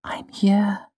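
gentle hushed reassurance; whispering comfort. A narrator's reading. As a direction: soothe him in a whisper. Auri comforts crying Kvothe.

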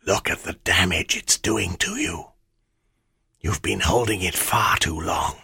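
hushed menacing shadow; dark whisper behind you. Acted. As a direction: breathe it out like a shade. Shadow Ammit speaks.

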